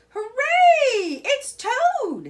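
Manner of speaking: high, loud, laughing hooray; joyful cheer. A narrator's reading. Mole cheering Toad's return.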